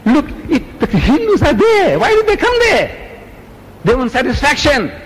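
high, pressing complaint; indignant. Real, not acted. Preacher complaining about meeting organizers, indignant and high. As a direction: pitch it up in disbelief.

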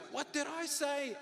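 high-pitched scolding teacher. Acted. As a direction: pitch it well up, exasperated teacher. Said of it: "Then you raise your voice".

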